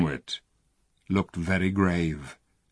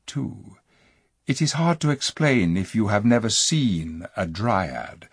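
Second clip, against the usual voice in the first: plain reading voice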